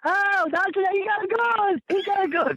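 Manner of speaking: high shrieking panicked woman; mock hysterical woman screaming; hollering woman. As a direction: shriek it like a terrified lady. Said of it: "She started hollering".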